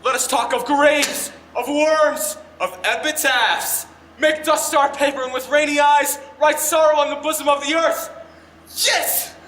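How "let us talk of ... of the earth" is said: loud, solemn stage declaiming; theatrical recital. Acted. Brother rehearsing King Richard, solemn and loud. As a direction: mourn graves like a tragic king.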